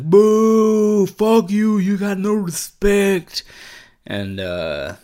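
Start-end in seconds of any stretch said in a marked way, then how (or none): 0.0-3.4 very high, loud drawn-out jeer; mock heckler. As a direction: boo and yell like a hammed-up heckler. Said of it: "somebody was like".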